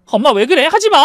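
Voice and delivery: high, loud cry to stop; alarmed yell. A narrator's reading. Daughter yelling at mother holding a knife, alarmed. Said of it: "하고 소리를 쳤지만".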